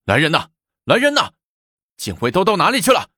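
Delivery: high, urgent call for help; straining shout. A narrator's reading. Alarmed official summons guards, straining shout. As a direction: call out for the guards, pitch leaping up. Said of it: "忙扯着嗓子喊".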